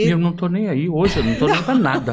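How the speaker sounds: laughing through the words; laughing dismissal. Real, not acted. Actor laughing off a question about his courage, amused. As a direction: shrug it off with a laugh.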